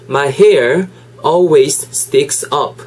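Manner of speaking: slow, word-by-word English demonstration; slow model sentence. Shown as a demonstration. Teacher repeating the sentence for learners, slowly. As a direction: say it slowly, separating each word. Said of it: "천천히 두 번만 더 해볼까요?".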